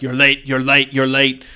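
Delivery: loud, scolding kitchen boss; hammed-up impatient chef ordering cooks around; angry head chef. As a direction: bawl the cooks back to work. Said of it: "the head chef bonks you each".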